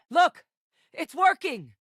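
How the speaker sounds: high, loud burst of excitement; excited discovery. Acted. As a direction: call out a breakthrough, thrilled.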